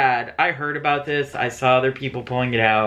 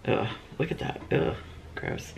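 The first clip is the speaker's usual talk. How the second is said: soft disgusted groan; grossed-out under the breath; annoyed ugh; 2 semitones lower, 10 dB quieter than usual. Real, not acted. Disgusted at leftover serum.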